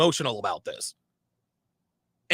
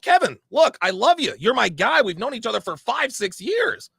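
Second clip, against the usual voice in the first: high-pitched, pleading reasoning with a friend; earnest appeal; 6 semitones higher, 3 dB louder than usual. Retold or imitated. Friend appealing to a longtime buddy, insistent plea. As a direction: reenact pleading with an old friend, pitch raised.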